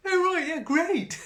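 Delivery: high, loud sarcastic "great"; sarcastic mock delight; fake enthusiasm. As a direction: fake cheer with a bite. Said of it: "And I went".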